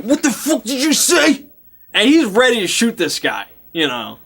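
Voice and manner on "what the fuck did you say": high, confrontational snarl; menacing challenge. Retold or imitated. Jake pointing a shotgun at the man, hostile. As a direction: threaten him with the question. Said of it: "But he's like".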